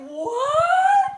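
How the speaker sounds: very high squeaked disbelief; acted-out stunned reaction; retold astonishment. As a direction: squeak it out in mock shock.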